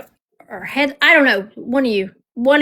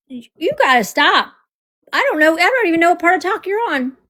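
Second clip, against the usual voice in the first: higher-pitched retold exasperation; exasperated quote, pitched up; mock scolding; 5 semitones higher than usual. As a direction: playfully tell off a friend. Retold telling-off of a friend.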